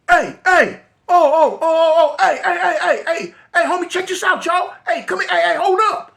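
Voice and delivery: high, loud frantic hey hey with laughs; panicked calling out. Retold or imitated. Calling to the gang coming down the street, panicked shout. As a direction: holler to stop the fools coming down the street.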